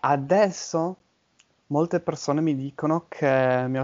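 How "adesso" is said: normal talking voice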